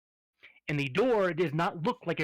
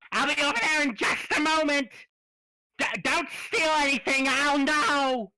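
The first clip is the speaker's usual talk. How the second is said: high, loud voice calling from behind the counter; unseen shopkeeper; 10 semitones higher, 5 dB louder than usual. Acted. Hidden shopkeeper warns the party not to steal, calling out high. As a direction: call out a warning from out of sight.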